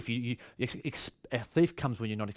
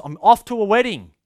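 the master announcing, higher and louder; lightly voiced master, raised and bright in volume; master off to a wedding; 8 semitones higher, 8 dB louder than usual. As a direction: call it out cheerfully as the master heading off. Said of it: "say to his servants".

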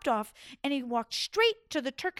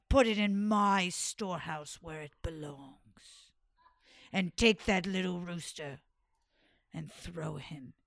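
low commanding sultan; 6 semitones lower than usual. Acted. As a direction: command the servants. Sultan orders servants.

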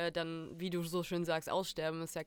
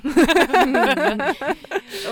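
big bright laugh, much louder; loud burst of laughter; 9 semitones higher, 17 dB louder than usual. Real, not acted. Loud laugh before farewell.

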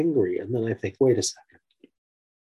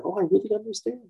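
a light hint of a higher girl's voice; child's voice; 10 semitones higher than usual. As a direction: agree like a small girl. Daughter voiced in retold story.

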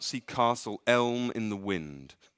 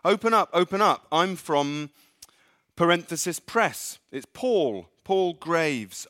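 loud, high, fast pushy reporter at the door; demon posing as reporter; 8 semitones higher, 8 dB louder than usual. Acted. Demon shouting through the door as a reporter, loud and high. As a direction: bang on the door as a fake reporter.

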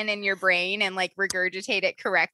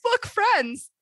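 eager dog voice; 11 semitones higher than usual. Pretend. Voicing her dog seeing cranes.

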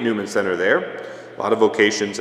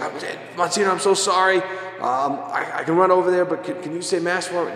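high, frantic pleading, voice up high; panicked apology; 9 semitones higher than usual. Retold or imitated. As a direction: imitate a priest who overslept, scrambling and begging. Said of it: "Father Jack is freaking out".

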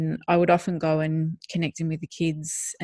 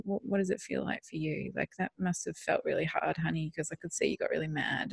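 soft, gentle and caring; 8 dB quieter than usual. Retold or imitated. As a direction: soften right down as if soothing a hurt partner. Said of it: "And I would so gently just say".

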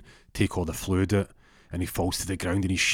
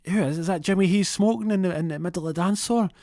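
Curtis puzzled; 12 semitones higher, 3 dB louder than usual. Retold or imitated. Curtis squinting through smoke.

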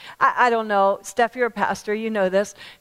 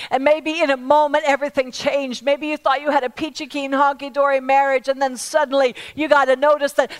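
raised, higher-pitched sermon delivery; impassioned preaching; 7 semitones higher, 6 dB louder than usual. Acted. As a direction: preach it urgently to the whole room. Preaches at full force.

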